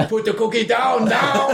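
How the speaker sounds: high shouted command; Schwarzenegger shout. Retold or imitated. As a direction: yell it like an action hero. Quoting Arnie line.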